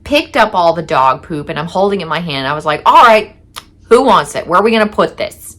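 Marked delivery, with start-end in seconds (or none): 2.9-5.5 louder, higher sarcastic call to the room; annoyed loud challenge. Retold or imitated. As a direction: hold it up and demand an answer. Holding dog poop, annoyed at group.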